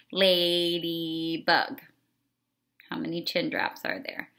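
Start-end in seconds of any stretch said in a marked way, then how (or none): 0.1-1.8 "Ladybug" said very slowly, each syllable drawn out; syllable stretch. Shown as a demonstration. Teacher modelling chin drops by stretching the word slowly. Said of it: "hand under your chin".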